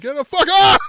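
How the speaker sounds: high, very loud imitated yell; Billy Gunn yelling. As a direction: burst from talk into a ringside scream. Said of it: "I can yell at him".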